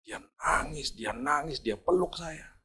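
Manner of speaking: hushed whisper. A narrator's reading. Preacher recalling the driver crying while they pray, whispered. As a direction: breathe it out softly.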